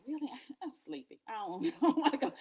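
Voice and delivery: high, sleepy complaint with a laugh in it; reluctant protest. Retold or imitated. Podcaster recounting answering God's call to pray, reluctant. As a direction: play-act not wanting to get up, half laughing.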